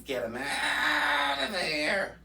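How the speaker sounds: slow, wailing shout; slow crying shout. Acted. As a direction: stretch it out and cry-shout the demand. Ranting about officials' positions.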